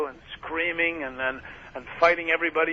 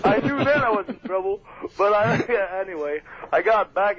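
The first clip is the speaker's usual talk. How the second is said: laughing while telling; 6 semitones higher, 3 dB louder than usual. Real, not acted.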